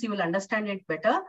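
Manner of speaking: ordinary talk, nothing marked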